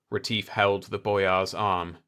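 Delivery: plain reading voice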